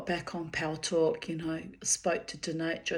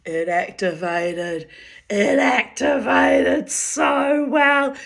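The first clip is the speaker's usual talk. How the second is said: slow, drawn-out, louder triumphant boast; gleeful reveal; 7 semitones higher, 9 dB louder, slower than usual. Retold or imitated. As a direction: savour each word of the triumph. She reveals her big night.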